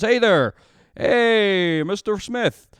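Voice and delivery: high, loud, hearty fake-friendly greeting; dad on the phone. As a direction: greet the other dad like an old pal, booming. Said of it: "He literally calls up".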